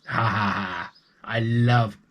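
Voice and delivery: slow, gloating giant chuckle; lightly voiced giant, savouring each word; greedy giant laugh. Giant counting his stolen coins, slow gloating.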